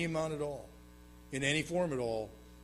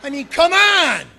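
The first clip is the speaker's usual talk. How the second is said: high, loud and slow come on; a big exasperated yell at the audience; exasperated outcry; 12 semitones higher, 17 dB louder, slower than usual. Real, not acted. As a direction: throw up your hands in disbelief. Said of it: "exasperated outburst".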